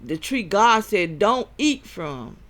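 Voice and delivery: loud, high fervent preaching. Acted. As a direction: preach it hard at the crowd. Preaching against third eye.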